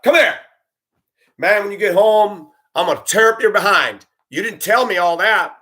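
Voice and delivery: a mother yelling at her boy from the bus step, hammed up; scolding mother.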